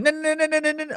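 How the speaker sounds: high rapid-fire no no no; rapid high moaning. Retold or imitated. Mimicking the porn actress, rapid and high. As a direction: rattle the nos off high like a machine gun. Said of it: "like a machine gun".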